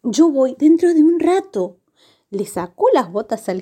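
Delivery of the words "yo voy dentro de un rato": slightly put-on high little-hero voice; Pulgarcito. A narrator's reading. Pulgarcito sends his brothers ahead, lightly voiced high. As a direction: reassure them, pitched up a little boy-hero's way. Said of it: "les dijo Pulgarcito".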